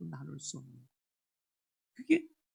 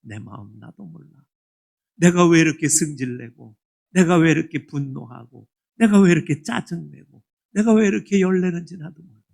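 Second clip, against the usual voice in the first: loud, frustrated outburst of a troubled heart; exasperated self-talk; 19 dB louder than usual. Retold or imitated. Pastor voicing a frustrated believer, loud exasperation. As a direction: vent like someone who can't understand their own anger. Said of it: "뭐라고 해요?".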